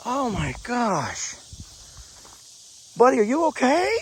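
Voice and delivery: high, drawn-out tender dismay; worried pet talk. Real, not acted. Farmer checking whether his hurt goat is okay, tender worried cooing. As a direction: stretch it out, high and pitying.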